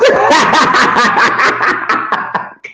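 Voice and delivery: long high laugh, cracking up; real helpless laughter at himself; high burst of laughter. Real, not acted. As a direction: crack up at your own words.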